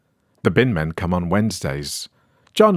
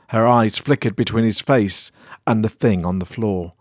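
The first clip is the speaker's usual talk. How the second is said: usual speaking voice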